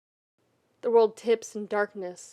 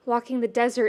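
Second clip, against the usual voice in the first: plain reading voice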